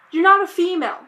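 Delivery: ordinary talk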